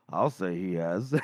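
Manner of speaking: low, slow dry aside; dry retort. Acted. Officer remarking on the boaster, low and dry. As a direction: drop it low and draw it out.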